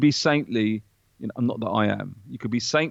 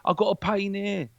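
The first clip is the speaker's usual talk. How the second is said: mock pleading patient, higher; lightly mocked pill-hungry patient; whiny patient; 7 semitones higher than usual. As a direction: beg the doctor for pills in a mocking whine. Mocking patients at the doctor's.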